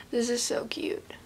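soft whisper. Real, not acted. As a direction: whisper it to yourself. Cooing at rabbit filter.

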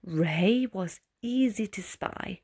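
plain reading voice

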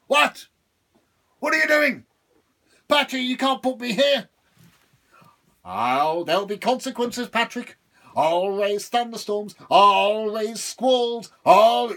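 high, loud devil threats, slowing on squalls; raging devil. Acted. Devil threatening Patrick with thunderstorms. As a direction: threaten storms in fury.

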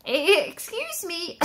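a shy cricket interrupting; timid cricket. Acted. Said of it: "coughed a cricket".

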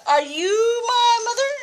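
high, plaintive crying call; crying baby bird. Acted. Grandma reading to James, baby bird calling to the cow, high and crying. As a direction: wail it high like a lost chick. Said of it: "he said to the cow".

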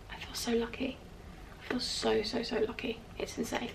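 whispering, overcome with gratitude; very soft, nearly voiceless wonder; hushed awe. Real, not acted. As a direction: whisper it as if you can hardly believe it. Overcome by gratitude for her gifts.

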